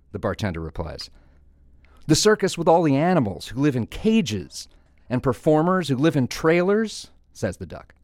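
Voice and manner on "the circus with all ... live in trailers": high-pitched disbelief, rising at the end; incredulous questioning. Acted. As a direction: list it as if it can't be true. Duck incredulously questioning the bartender.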